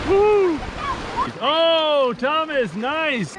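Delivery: high whoop of joy; cheering. Real, not acted. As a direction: whoop for the catch. Cheering a catch.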